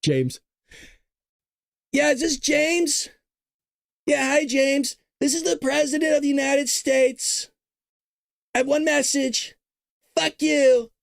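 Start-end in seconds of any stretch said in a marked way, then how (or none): 1.9-10.9 Trump impression. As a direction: play Trump calling the judge to insult him. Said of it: "that's what Donald Trump did".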